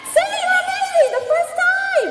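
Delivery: big, high-pitched hype shout. Real, not acted. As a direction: yell it out to the whole room. Crowd hype call-out.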